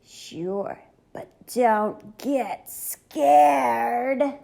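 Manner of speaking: monster voice. A narrator's reading. Third monster under the bed.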